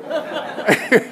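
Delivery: sudden high-pitched chuckle; high laugh. Real, not acted. Laughing at the smug ego.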